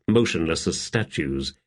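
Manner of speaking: usual speaking voice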